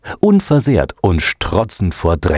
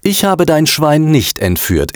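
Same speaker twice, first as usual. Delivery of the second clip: put-on high, irritated girl's denial; annoyed; 9 semitones higher than usual. A narrator's reading. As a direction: deny it crossly, pitched up. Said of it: "erwiderte das Mädchen ärgerlich". (ärgerlich, mit hoher Stimme)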